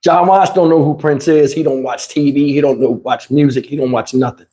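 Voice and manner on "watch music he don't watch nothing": ordinary delivery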